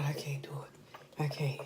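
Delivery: faint defeated whisper. Real, not acted. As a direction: whisper it, spent. Giving up on food.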